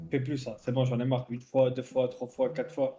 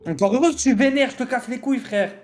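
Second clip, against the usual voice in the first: high, exasperated protest; heated; 7 semitones higher than usual. Real, not acted. Heated quarrel, pleading back.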